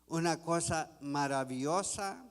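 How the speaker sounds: usual speaking voice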